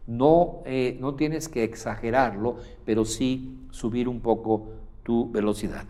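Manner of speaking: ordinary talk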